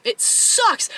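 SUCKS leaps high and stretched; high, drawn-out stress on sucks; emphatic disgust. Real, not acted. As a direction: squeal the word sucks in misery. Reliving the freezing shower.

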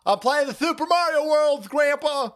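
put-on high bratty kid voice; excited kid. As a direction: answer like a proud little kid. Kid self answering grandpa.

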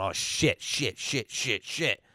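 low, hushed string of curses; retold private panic, kept very quiet; panicking under breath. Bluffer caught out about the game, panicking under his breath. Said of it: "so now I'm panicking".

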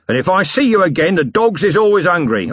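a tough businessman barking a warning; dogs-is-hungry threat from a self-made boss; gruff boss Harry King. Acted.

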